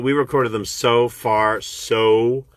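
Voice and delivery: ordinary delivery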